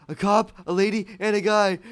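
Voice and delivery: high, loud listing; mock-frantic report. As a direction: punch out each victim in mock alarm. Acts out reporting a murder.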